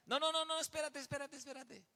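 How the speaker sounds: put-on a boy stalling his dad, pleading; pleading kid. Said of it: "Papá". (niño suplicante con voz mucho más aguda)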